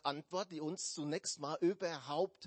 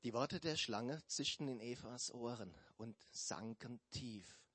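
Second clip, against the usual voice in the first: ordinary talk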